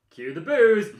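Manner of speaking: loud, high, boomed out; hammed-up ring announcer call; mock announcer shout. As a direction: bellow it like a wrestling promo. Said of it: "Reigns just goes".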